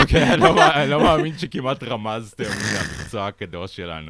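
high, loud indignant outburst; offended mathematician. Retold or imitated. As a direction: play a huffy, offended mathematician. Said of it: "מתמטיקאים שמקשיבים עכשיו שהם כזה". (מתמטיקאי נעלב ומתלהם)

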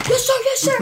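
high-pitched mock military reply; frantic obedient cadet. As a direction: imitate a cadet snapping to attention. Acting out cadet answering screamed orders.

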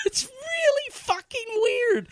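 high squealing laugh through the words; cracking up in disbelief; laughing hard. Real, not acted. Laughing to co-hosts about the weird scene.

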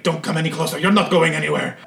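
high-pitched defensive threat; nervous cultist's warning. Acted. Cultist warns off intruder with dagger.

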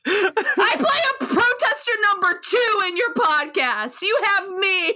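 high, through helpless laughter; laughing protest. Real, not acted. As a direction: protest while cracking up, pitched high. Incredulous protest at praise, laughing.

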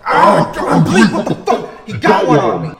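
very loud hammed-up cry of being stabbed; acted agonized shouting; shocked pained yell. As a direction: yell out as if suddenly run through. Acts the stabbed Sith's shock.

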